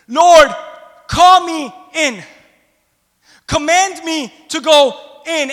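loud high plea, drawn out slowly; 1 fervent cry. Acted. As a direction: call out slow and loud as Peter. Preacher voicing Peter's plea.

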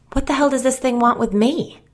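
high, loud, defensive demand. A narrator's reading. Narrator demanding what the entity wants, defensive. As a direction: push the question up in pitch, on the defensive. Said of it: "I said defensively".

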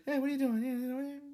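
put-on very high baby talk; high pet voice to the dog. Shown as a demonstration. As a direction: coo it way up high to the puppy. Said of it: "you gotta be like".

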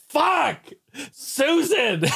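high mock curse at wife; neighbor cursing. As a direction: squeal the name, exasperated. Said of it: "And I just hear".

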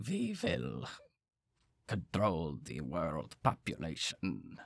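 slow, sinister world-domination voice; unhinged villain. Pretend. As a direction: drag it out like a mad villain. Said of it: "my Klaus Schwab accent".

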